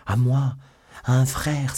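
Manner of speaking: high, loud, urgent cry; exclaiming. A narrator's reading. Felton begs Milady to confide in him, exclaiming. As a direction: cry it out, pitched well up. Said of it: "s'écria Felton".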